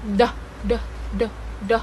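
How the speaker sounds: "D, d" tapped against the roof of the mouth; African-style "d" for t; d sound at roof of mouth. Shown as a demonstration. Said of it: "He pronounces it at the roof of".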